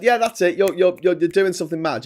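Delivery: high, loud, amazed stranger; excited recognition. Retold or imitated. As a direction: burst out as if you just spotted a celebrity. Stranger recognises him from telly.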